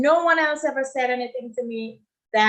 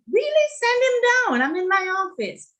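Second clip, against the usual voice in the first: much higher, louder, bursting with delighted disbelief; thrilled surprise; 11 semitones higher, 5 dB louder than usual. Retold or imitated. Director hears her old boss came for a job, excited high squeal. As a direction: squeal it like the best news of the day. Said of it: "I was like".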